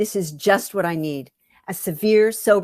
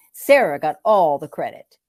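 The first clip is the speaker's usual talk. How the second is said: plain reading voice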